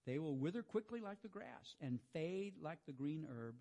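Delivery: quiet, unhurried reading of the psalm; soft scripture reading. Acted. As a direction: let the words wash over them gently. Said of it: "I'm just gonna read this to you".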